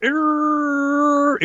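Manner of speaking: long high whirring "errr"; platform motor noise. Acted. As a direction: hum like a lift motor straining upward. Imitates the rising platform's motor.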